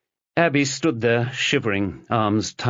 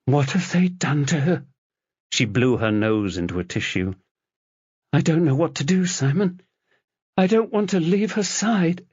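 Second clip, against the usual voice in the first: tearful; 9 semitones higher than usual. A narrator's reading. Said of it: "tearing up".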